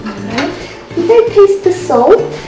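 ordinary delivery

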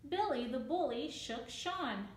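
usual speaking voice

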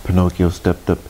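plain reading voice

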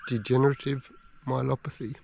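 quiet, soft, choked-up musing; holding back tears while speaking; emotional. Real, not acted. Alone in the bush, telling viewers about his dying dog, softly emotional. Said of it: "I'm feeling a little bit emotional".

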